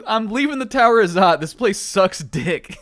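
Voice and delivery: fed up. Acted. Fed-up character line.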